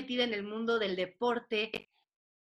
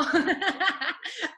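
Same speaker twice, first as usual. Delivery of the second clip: laughing; 6 semitones higher than usual. Real, not acted. Se ríe tras comentar artes plásticas.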